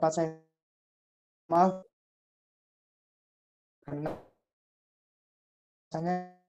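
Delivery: choppy, cutting-out audio; words dropping out over bad connection; breaking up connection. Real, not acted. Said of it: "putus-putus lagi".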